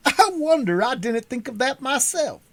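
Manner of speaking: a dim, wondering high voice; Pumpkinhead voice. A narrator's reading. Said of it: "said the Pumpkinhead".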